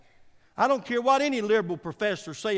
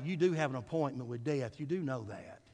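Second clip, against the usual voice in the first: usual speaking voice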